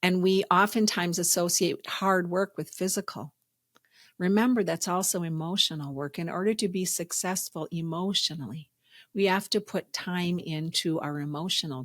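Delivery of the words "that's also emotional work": ordinary talk, nothing marked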